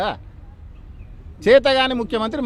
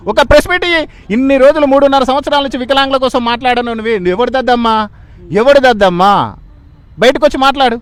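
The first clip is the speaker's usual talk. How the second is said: raised voice, indignant and demanding; loud, forceful challenge; 5 dB louder than usual. Real, not acted. Protester challenging the Chief Minister to come out and speak, loud and forceful. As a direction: throw the challenge out loud and hard. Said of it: "ఇంత గట్టిగా మాట్లాడుతున్నావు".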